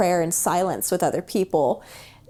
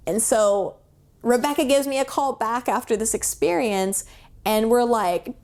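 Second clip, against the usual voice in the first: ordinary talk, nothing marked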